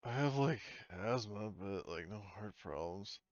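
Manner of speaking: ordinary delivery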